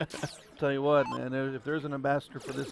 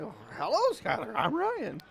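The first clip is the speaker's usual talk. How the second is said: hammed-up sugary hello that climbs much higher; mock sweet greeting; 8 semitones higher, 4 dB louder than usual. Announcer retelling his greeting to Skylar, hammed-up high and sweet. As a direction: play it like an over-polite, bashful introduction. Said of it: "I'm like".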